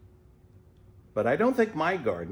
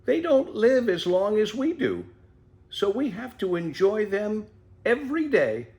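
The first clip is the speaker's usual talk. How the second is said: put-on higher, gentle old mother explaining; wise mother tortoise; 8 semitones higher than usual.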